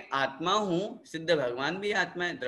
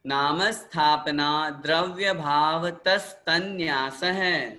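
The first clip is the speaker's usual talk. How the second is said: sutra recitation; slower than usual. Shown as a demonstration. Teacher recites sutras for repetition.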